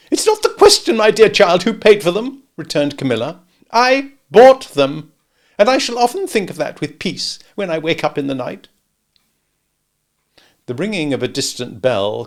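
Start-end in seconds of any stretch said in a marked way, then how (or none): 0.1-8.7 high, loud fussy lady's lament; Camilla complaining. A narrator's reading. Camilla grumbles to relatives about Matthew, high and loud. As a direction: whine on in high pitch about her husband.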